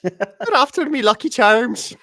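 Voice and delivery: high, loud stage-Irish lilt; hammed-up leprechaun voice. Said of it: "They want me to talk like a leprechaun".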